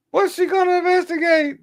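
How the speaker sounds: very high, mocking falsetto repeat; falsetto scoff. Real, not acted. Streamer mocking Maxine Waters in falsetto. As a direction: ask it again in falsetto. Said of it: "repeat scoff higher".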